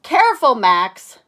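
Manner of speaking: high, loud warning cry; Arthur warning. Acted. Arthur warns Max near the painting, loud high caution. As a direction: call out a sharp caution. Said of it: "Arthur says".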